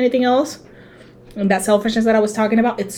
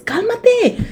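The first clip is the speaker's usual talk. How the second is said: high, pleading mock plea; exasperated plea to mom; 13 semitones higher, 3 dB louder than usual. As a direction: beg mom to sit, pitched way up. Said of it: "But I was like".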